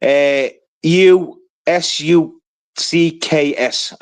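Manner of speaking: slow, letter-by-letter spelling. Shown as a demonstration. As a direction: spell it out slowly, one letter at a time. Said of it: "the spelling is".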